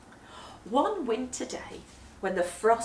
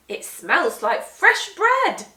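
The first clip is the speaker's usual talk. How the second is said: louder and slower, savouring it; delighted discovery; 6 semitones higher, 11 dB louder, slower than usual. Acted. Missus Molly telling the children with delight about the bread smell. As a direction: announce it with relish.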